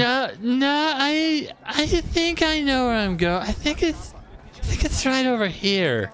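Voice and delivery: high-pitched drunk impression; fake drunk voice. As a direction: play it like you've had too many. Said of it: "That's my impression of a drunk person".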